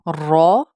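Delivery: long, weighty رو; thick, bold letter. Shown as a demonstration. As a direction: say رو as a full "raw". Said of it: "رو کو بھی موٹا پڑھا جائے گا".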